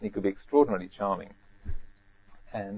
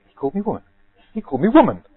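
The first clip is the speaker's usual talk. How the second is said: high, outraged lady's voice building to a loud cry; mock outrage swelling louder and higher; incensed and aghast; 12 semitones higher, 12 dB louder, faster than usual. Offended woman to her friend, louder and more outraged. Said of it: "utterly incensed and aghast".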